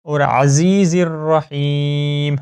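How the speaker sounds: عزیز with the ز drawn out long and slow; long zaa. Shown as a demonstration. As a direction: stretch the ز of عزیز. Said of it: "زا کو آپ نے لمبا کرنا ہے". (عزیز میں زا کو لمبا کر کے)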